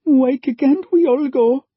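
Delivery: put-on a scared child begging, breaking off; young girl pleading to keep her family together; frightened girl. A narrator's reading.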